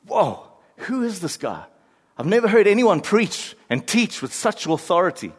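awed, wide-eyed question rising high; amazed onlooker. Acted. Preacher voicing stunned crowd, awed. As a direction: marvel at him like a stunned listener.

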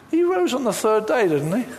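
very casually. Retold or imitated. Teen answering his sister about Jesus, casually offhand. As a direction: toss it off like it's nothing. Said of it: "I said very casually".

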